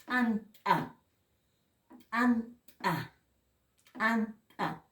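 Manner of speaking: ANTA with popped ejective t sounds, as t'ant'a; glottalized T. Shown as a demonstration. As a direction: pop each t of t'ant'a from a closed throat. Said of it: "Aquí con el apóstrofo".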